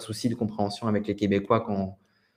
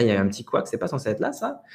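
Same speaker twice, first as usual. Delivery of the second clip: usual speaking voice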